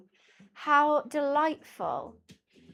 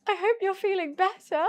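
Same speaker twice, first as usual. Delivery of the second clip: awkward reply; 7 semitones higher than usual. Retold or imitated. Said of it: "And I was like".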